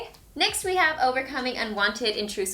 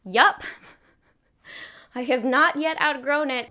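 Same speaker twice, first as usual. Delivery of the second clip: laughing; 4 semitones higher, 4 dB louder than usual. Retold or imitated. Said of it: "that I'm like".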